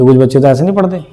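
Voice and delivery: normal talking voice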